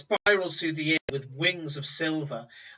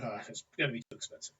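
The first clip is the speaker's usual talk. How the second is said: quick, soft grumbling aside; muttering; 4 semitones lower, 7 dB quieter, much faster than usual. Acted. Bystanders grumbling softly about the cost. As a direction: crowd members mutter about the price. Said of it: "a few people kind of there muttering".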